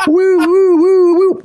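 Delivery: high whooping police siren; siren noise. Retold or imitated. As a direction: whoop like a cop car siren.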